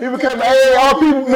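rapid-fire joke breaking into loud laughter; laughing joke. Real, not acted. Host joking the guest is an AARP member, laughing. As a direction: rattle it off fast then laugh.

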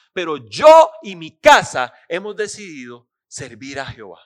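loud, forceful challenge to the people; Joshua angry. Acted. Joshua angrily challenging Israel to choose whom to serve, loud. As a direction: thunder it like an angry old leader. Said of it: "se enoja y se levanta y les dice".

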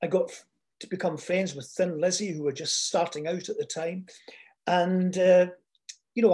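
normal talking voice

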